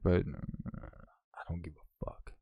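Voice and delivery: ordinary talk, nothing marked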